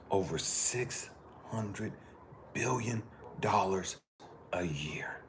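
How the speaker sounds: stat dropped to a slow whisper; dramatic whisper. Real, not acted. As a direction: let the number sink in, whispering.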